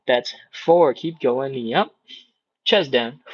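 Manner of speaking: ordinary talk, nothing marked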